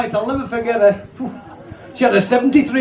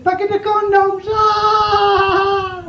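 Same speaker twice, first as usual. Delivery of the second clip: put-on high, strained cry of a nervous young lad; high squeaky young lad blurting his order; lad's voice; 10 semitones higher, much slower than usual. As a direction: squeal the order then bolt.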